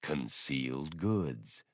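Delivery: slow, low, pointed ending; drawled. A narrator's reading. Droid pointing at Blue Max, slowly and low. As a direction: land the punchline slowly. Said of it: "Bollocks drawled".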